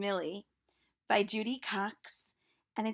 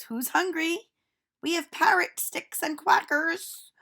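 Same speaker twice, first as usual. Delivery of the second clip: put-on a cheerful teacher calling out to children; silly teacher voice; 7 semitones higher, 7 dB louder than usual. Teacher speaks.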